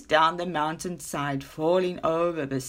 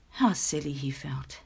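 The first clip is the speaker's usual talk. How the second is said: usual speaking voice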